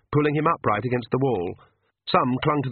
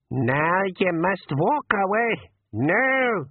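put-on old pixie voice; 9 semitones higher, much slower than usual. A narrator's reading. Old pixie gives orders.

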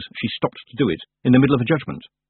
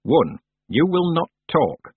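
slow and stern; 7 semitones higher, slower than usual. A narrator's reading. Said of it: "said the old witch".

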